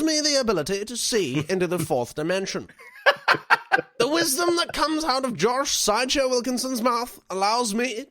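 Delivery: voz teatral de reseña. Acted. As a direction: read it like a pompous, lofty testimonial. Reading a listener's review theatrically.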